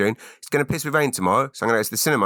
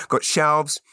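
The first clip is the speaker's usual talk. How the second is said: ordinary talk, nothing marked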